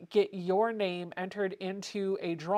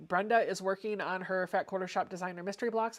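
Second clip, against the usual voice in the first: normal talking voice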